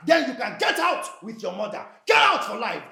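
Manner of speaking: loud, furious dismissal; forceful angry eruption; angry shouting. Acted. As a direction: shout them out of the house. Husband orders wife and mother out.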